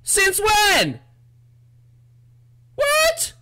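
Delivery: very high shouted shock; startled scream. Real, not acted. Player caught off guard by the ghost, high startled screaming. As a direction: shriek it in alarmed disbelief.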